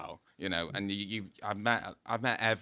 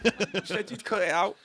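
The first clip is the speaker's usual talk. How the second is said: much higher, playful complaint; mock accusation; 12 semitones higher, 7 dB louder than usual. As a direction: tease him for leaving it in. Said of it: "I'm like".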